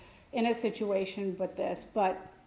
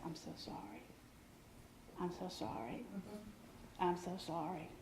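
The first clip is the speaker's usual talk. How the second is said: barely audible, soft condolence; murmured sympathy; 4 semitones lower, 20 dB quieter than usual. Retold or imitated. Johnny murmuring condolences to mourners at funerals, softly. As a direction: murmur it low like greeting mourners. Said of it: "he'd say".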